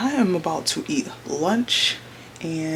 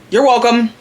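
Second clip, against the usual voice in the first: much louder, higher cheeky call; loud playful shout; 7 semitones higher, 12 dB louder than usual. Real, not acted. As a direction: shout it out like a joke gift. Cheeky sign-off to viewers.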